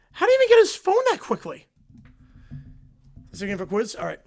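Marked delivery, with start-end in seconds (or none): 0.1-1.7 much higher, louder incredulous question; baffled at how fast he was; disbelief. Real, not acted. Streamer marvels at the tweet speed.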